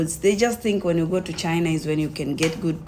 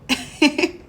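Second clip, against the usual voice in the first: brief laugh; 10 semitones higher than usual. Real, not acted. Laughing about the bet.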